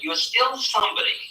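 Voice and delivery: ordinary delivery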